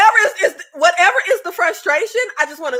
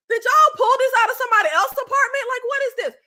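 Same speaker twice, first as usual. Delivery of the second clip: incredulous disbelief; 10 semitones higher, faster than usual. Retold or imitated. Ranting about old dishwasher.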